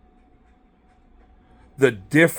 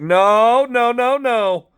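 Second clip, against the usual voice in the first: mock protest; 6 semitones higher, 4 dB louder, much slower than usual. Refusing the plug-in cell.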